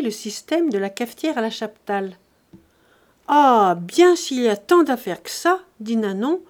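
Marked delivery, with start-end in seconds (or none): 3.3-5.6 indignant servant. A narrator's reading. Servant objects to Charles's fancy coffee method, indignant. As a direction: scoff loudly at all that fuss.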